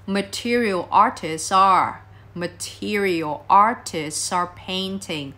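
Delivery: slow demo linking the S of "artists" into "are"; "material artists are painting" drawn out, S gliding into the vowel; linked sounds. Shown as a demonstration. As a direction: say "artists are" joined as "arti-sar", slowly. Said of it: "nối âm đúng không?".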